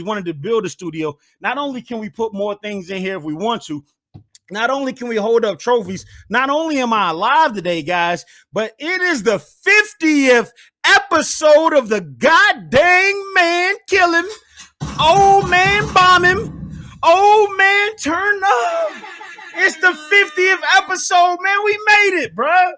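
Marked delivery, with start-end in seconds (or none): 4.5-7.8 building hype. Real, not acted. 8.5-18.9 high, loud, slow shouted show title; crying out the show's name in a long, stretched-out yell; hype announcement. Real, not acted. Announcing the fiftieth episode. 19.5-22.7 high, loud, laughing joy; laughing, celebrating yell; triumphant celebration. Real, not acted. Celebrating fiftieth episode.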